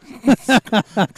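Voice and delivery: big burst of laughter; loud high laugh. Real, not acted.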